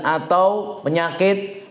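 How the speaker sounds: normal talking voice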